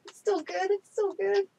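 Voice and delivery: high, rising, insisting it's fine; squeaky high insisting. Acted. Toast insists while covered in ants.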